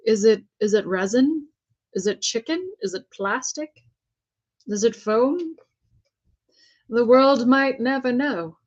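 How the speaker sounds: playful put-on British accent, posh and theatrical; mock British accent. Painter joking to viewers about her rotisserie technique, in a mock British accent. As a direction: ham up a British accent for a joke. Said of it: "I don't know what kind of accent that was".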